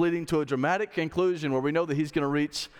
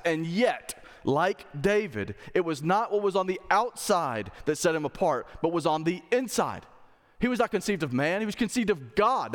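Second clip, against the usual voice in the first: raised pitch, measured rhetorical cadence; emphatic preaching; 5 semitones higher than usual. Real, not acted. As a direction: preach it in building contrasts, leaning on key words. Preaches on the nativity.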